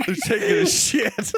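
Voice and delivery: high, slow, laughing through the line; laughing quote. Retold or imitated. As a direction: crack up while quoting the line. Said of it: "exact words".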